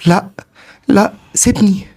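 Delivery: put-on high, panicked child yell; crying child's scream. A narrator's reading. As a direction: cry out like a terrified little boy. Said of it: "وبيصرخ".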